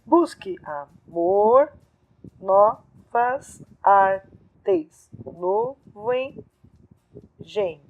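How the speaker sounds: very slow, chopped verse reading; syllable-by-syllable scansion. Shown as a demonstration. Teacher scanning a verse for students, syllable by syllable. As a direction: read the line one syllable at a time, with gaps. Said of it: "vou deixar junto por enquanto".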